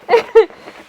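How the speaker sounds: a quick bright laugh at her own line; real laugh about loving gains; high laugh. Real, not acted.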